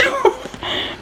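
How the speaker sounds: high, soft squeak of laughter; helpless little high laugh; amused laugh. Real, not acted. Reader cracking up at her book, high amused laugh. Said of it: "That's so fucking hilarious".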